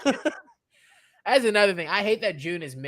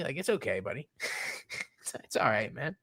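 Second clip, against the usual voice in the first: soft, low mock comfort; reassuring; 3 semitones lower, 6 dB quieter than usual. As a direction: pat him on the back with your voice. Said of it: "I was like".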